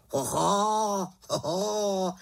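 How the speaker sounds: raven's drawn-out hoot; astonished raven call; exclaiming. Acted. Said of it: "zvolal Abraxas".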